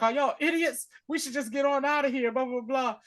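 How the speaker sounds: high-pitched, exasperated mock whining; high, hurried griping that tails into dismissal; Rampart. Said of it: "Rampart was like".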